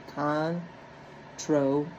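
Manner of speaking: slow, deliberate controversial; drawn out. Shown as a demonstration. Teacher modelling the word for students, slowly. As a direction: stretch the word out as you model it. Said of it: "So controversial".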